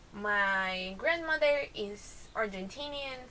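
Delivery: ordinary delivery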